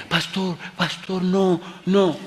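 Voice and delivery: retold mother warning the pastor off, high and pleading; alarmed woman. Mother stops the pastor beside her son, alarmed. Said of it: "Y dice la señora".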